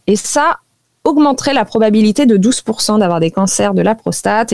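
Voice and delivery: usual speaking voice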